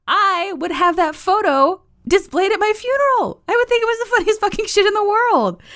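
very high-pitched, hammed-up indignation; mock indignant retort. Host retelling the retort to Jared about the meme, mock indignant. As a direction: protest in an exaggerated high squeal.

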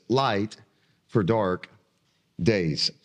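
each word set apart with pauses, Light, for, Dark, Days; title, word by word. Shown as a demonstration. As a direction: announce the title slowly, one word at a time. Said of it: "I've titled the message for today this".

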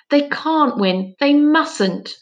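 bursting out. A narrator's reading. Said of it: "burst out Finn".

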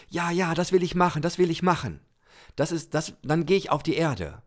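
high, eager burst of joy; a little soul that can hardly wait; full of joy. Pretend.

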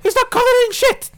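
high-pitched, loud Python-style voice; hammed-up film quote in a high voice; Monty Python peasant. Quoting Holy Grail line in character.

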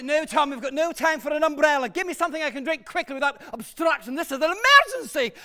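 worked-up emergency orders; impatient shouting. Acted. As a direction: shout back, urgent and impatient. Impatient demand for quick drink.

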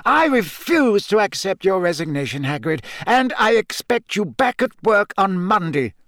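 put-on high-pitched, emphatic old headmaster; firm headmaster. Headmaster reassuring his gamekeeper, firm and insistent. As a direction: press the point as headmaster. Narrator voices Dumbledore.

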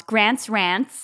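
"Grant's Rants" in a tried-on Southern American twang, rising; Southern girl saying "Grant's Rants" with front a; attempted Southern accent. Host tries guest's version of his title, imitated Southern American accent. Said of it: "sound like a Southern girl".

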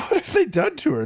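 high mock exasperation. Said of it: "I was like".

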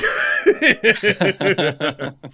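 loud, high burst of laughter; long helpless giggling fit; big laugh. Real, not acted. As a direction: crack up at your own bit. Laughing at own American bit.

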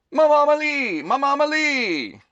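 high, loud call across a room; shouting across the diner. Acted. Customer calling across the diner, loud and high. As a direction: call out to her from the far side. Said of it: "a customer shouted".

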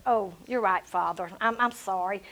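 low, soft, backing down; meek retold apology. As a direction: give in meekly to your Father. Meekly apologising to God in retelling.

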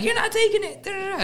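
high-pitched squealed protest; put-on falsetto quarrel; mock arguing. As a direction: bicker in a silly high voice. Acting out the towel argument.

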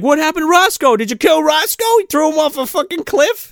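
high, loud badgering questions; mock yelling. Neighbor yelling at midnight about Roscoe, mock yelling. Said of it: "yelling this in".

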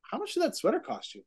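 wife quizzing husband about the sweater, high mock voice; imitating Lindsay. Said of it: "Lindsay said to me".

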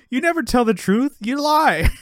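high, exasperated retold accusation; hammed-up quarrel outburst; mock accusation. Retelling an acted quarrel.

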